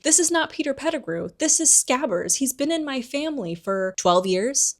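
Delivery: high-pitched incredulous protest; indignant Ron. Retold or imitated. Ron defends his pet rat to the adults, indignant.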